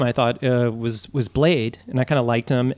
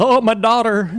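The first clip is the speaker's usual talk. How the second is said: higher, louder mock [name] lament; [name] at a funeral; 8 semitones higher, 4 dB louder than usual. Friend imagining the actor grieving as [name], hammed-up. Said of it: "He goes to [name]'s funeral".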